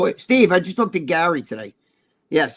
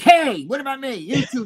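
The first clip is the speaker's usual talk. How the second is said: high-pitched mock complaint; raised voice, imitating an indignant brother; George protesting; 8 semitones higher than usual. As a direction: play the left-out brother piping up. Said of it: "Your, your brother George is probably like".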